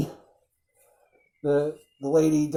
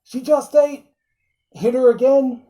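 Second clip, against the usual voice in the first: much higher, put-on old doctor voice; a hammed-up matter-of-fact Van Helsing; imitating Van Helsing; 9 semitones higher, 4 dB louder than usual. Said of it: "Mel, as Van Helsing, he's like".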